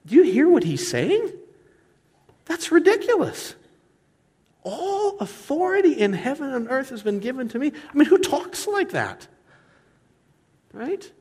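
high-pitched, astonished disbelief; incredulous. Real, not acted. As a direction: say it as if the claim is outrageous, voice way up high. Preacher marvels at an outrageous claim.

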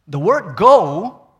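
"go" loud and drawn out; slow, punched emphasis on "go"; stress on go. Shown as a demonstration. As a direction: lean hard on the word "go". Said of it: "the emphasis is on going".

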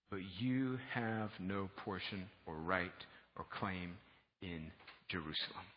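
low, soft, measured declaration; solemn scripture reading. Acted. Nehemiah answering his opponents, solemn scripture reading. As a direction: let each phrase land, hushed and deliberate.

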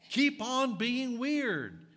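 high, loud and slow, stretching every word out; preached shout. Acted. Preacher rallying the congregation to stay weird, booming slow shout. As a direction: belt it to the back pew like a revival preacher.

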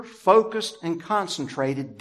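ordinary talk, nothing marked

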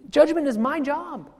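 high, insistent paraphrase of Jesus; voicing Jesus. Preacher voices Jesus telling the crowd not to judge, high and insistent. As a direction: speak as Jesus telling them to stop judging. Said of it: "See, Jesus says".

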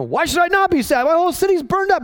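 high, pleading cry of grief; heightened lament, pitched well up; dramatic lament. Retold or imitated. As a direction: wail it like a grieving man. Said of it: "and it's really dramatic".

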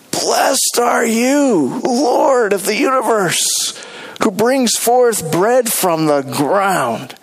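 slow, earnest prayer voice. Acted. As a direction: pray it out slowly and reverently. Prays aloud, slow.

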